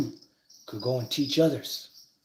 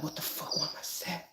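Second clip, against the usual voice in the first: near-whispered, much softer puzzled self-question; confused muttering; 2 semitones higher, 12 dB quieter than usual. Retold or imitated. Someone suddenly sad asks themselves why, hushed and puzzled. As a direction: mutter it to yourself under your breath, baffled.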